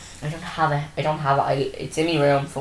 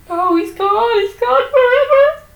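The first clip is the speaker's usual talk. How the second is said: much higher, loud mock sobbing wail; 5 dB louder than usual. Podcaster reenacts crying at the episode, high, loud mock wail. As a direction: wail it like a fake sob, very high. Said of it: "Sorry if I deafened just there".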